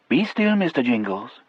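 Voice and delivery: a hint of Cajun lilt, coaxing his mouse; Cajun accent. A narrator's reading. Cajun Delacroix voiced in story.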